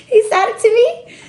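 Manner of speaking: triumphant sing-song repeat; gleeful gloating. Real, not acted. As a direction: crow about the win, climbing up. Gloating he got the deal.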